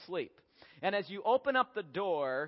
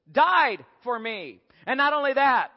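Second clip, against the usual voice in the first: self-centred proclamation; 4 semitones higher, 8 dB louder than usual. Acted.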